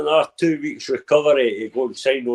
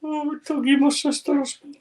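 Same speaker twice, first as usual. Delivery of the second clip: mocking; 9 semitones higher, 5 dB quieter than usual. Mocking the previous line.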